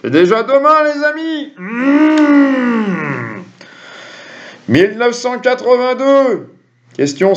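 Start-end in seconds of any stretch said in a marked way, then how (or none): none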